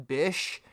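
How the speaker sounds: low, soft "Bish" for bitch; missing T. Retold or imitated. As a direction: tack on "Bish" ending on sh, no T. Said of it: "pronunciate the T".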